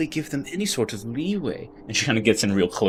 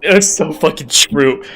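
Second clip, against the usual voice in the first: slow, louder and higher burst; exasperated agreement; 6 semitones higher, 9 dB louder, slower than usual. Acted. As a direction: give in with a loud groan of agreement. Sergeant gives up, slams fist.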